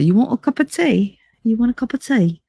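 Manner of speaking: put-on playful British accent, a little high. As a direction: offer tea in a mock British accent. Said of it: "Talk with the British accent".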